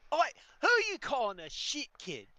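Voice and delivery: high-pitched challenge; offended kid. Acted. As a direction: pick a fight like a cocky brat.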